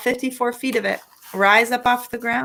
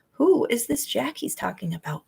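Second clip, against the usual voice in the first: ordinary delivery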